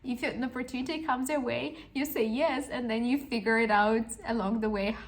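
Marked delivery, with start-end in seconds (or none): none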